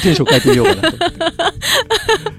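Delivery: stifled giggles. Real, not acted. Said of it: "ちょっと笑いをこらえてた。".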